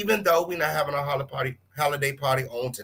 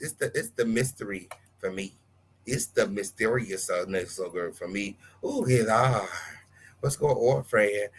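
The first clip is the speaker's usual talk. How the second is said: ordinary talk, nothing marked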